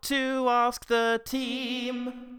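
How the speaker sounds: upbeat show announcer voice; big podcast welcome; announcer intro. Acted.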